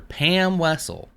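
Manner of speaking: drawn-out high Pam Wessel; Spooky's name for her. Retold or imitated. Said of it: "as Spooky calls her".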